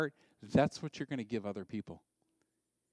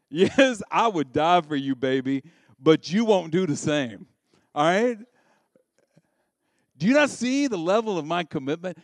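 loud, higher, wounded protest; indignant lover; 4 semitones higher, 10 dB louder than usual. Acted. Husband scolding his wife for not committing, loud indignation. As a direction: demand she sees your sacrifice.

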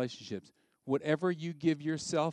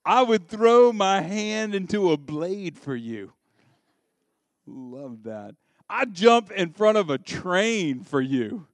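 louder, slow, overblown declaration; mock-dramatic vow; 5 semitones higher, 9 dB louder than usual. Husband overpromising to an unloving wife, loud and slow. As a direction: belt it out like a lovesick hero.

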